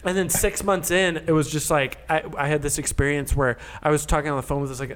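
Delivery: ordinary delivery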